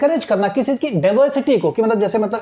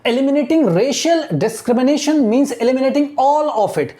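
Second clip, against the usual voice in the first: very slow, word by word reading; slow classroom dictation of English text; 3 semitones higher, much slower than usual. Shown as a demonstration. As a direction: read it out slowly so students follow. Teacher dictating newspaper line slowly.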